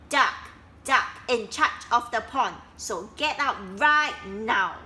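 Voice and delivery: loud commanding order; bossy order. Acted. Duck ordering the frogs out, loud and bossy. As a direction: throw them out.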